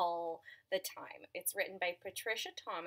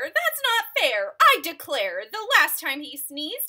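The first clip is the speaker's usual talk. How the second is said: high, loud indignant bear; complaining bear; 7 semitones higher, 13 dB louder than usual. Acted. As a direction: protest like a put-upon bear.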